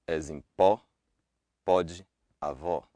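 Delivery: pó, pode, avó with an open ò; Portuguese open o. Shown as a demonstration. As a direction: open the o wide. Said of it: "only happens in stressed syllable".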